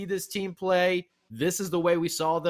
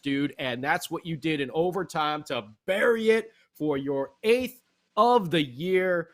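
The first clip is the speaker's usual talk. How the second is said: ordinary talk, nothing marked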